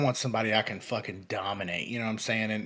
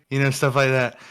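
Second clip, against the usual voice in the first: ordinary delivery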